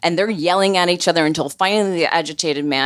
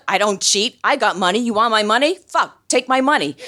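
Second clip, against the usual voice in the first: higher, agitated mock yelling; 6 semitones higher than usual. Agitated man insisting he has money, agitated. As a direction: imitate a worked-up man protesting. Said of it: "this really agitated guy".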